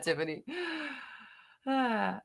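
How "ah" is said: normal talking voice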